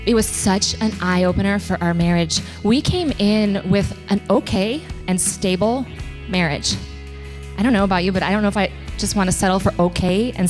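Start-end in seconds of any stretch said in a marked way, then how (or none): none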